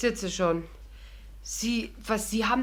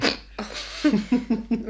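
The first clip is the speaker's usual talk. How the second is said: surprised laugh. Acted. Laughs at the sudden proposal.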